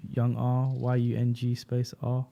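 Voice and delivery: "Y U N G space R" slowly, letter by letter; slow spelling. Shown as a demonstration. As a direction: spell the handle out slowly for listeners. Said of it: "spelling out his YouTube handle".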